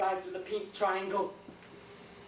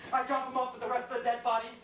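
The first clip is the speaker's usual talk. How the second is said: normal talking voice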